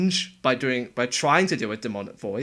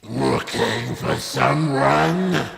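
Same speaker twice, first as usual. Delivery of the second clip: slow raspy processed growl; Kara voice; 4 dB louder, much slower than usual. Shown as a demonstration. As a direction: rasp it slowly and sinister. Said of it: "if I go back to the Kara voice".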